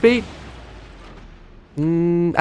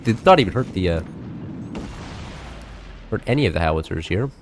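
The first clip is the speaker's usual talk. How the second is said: ordinary talk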